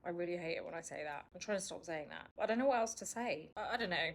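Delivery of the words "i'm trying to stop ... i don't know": ordinary delivery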